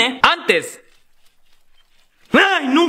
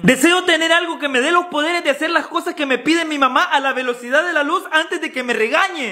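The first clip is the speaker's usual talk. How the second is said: ordinary talk, nothing marked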